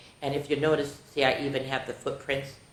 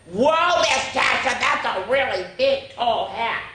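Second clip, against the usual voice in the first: put-on high, slow gasp of wonder like a child; amazed kids; 9 semitones higher, 4 dB louder, slower than usual. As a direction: imitate kids staring at a tall hat. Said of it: "the kids went".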